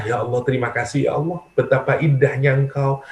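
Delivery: soft, hushed words of loving gratitude; tender prayer. Acted. A worshipper softly thanking Allah after night prayer, tender. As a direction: breathe it out softly like a grateful lover.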